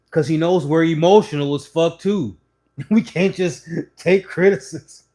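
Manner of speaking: laughing, loud confession; laughing admission. Real, not acted. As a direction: laugh at your own people.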